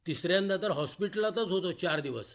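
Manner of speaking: ordinary delivery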